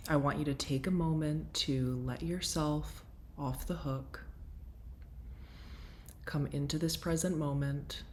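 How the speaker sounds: soft, settling guide voice; guided breathwork. Acted. Settling listeners for breathwork, in a soft guiding voice. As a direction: ease the listeners gently into the session.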